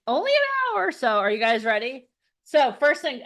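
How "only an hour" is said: high mock echo. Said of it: "I'm like".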